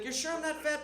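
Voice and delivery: high-voiced kid seeking reassurance; doubting child. Child asks mother for reassurance.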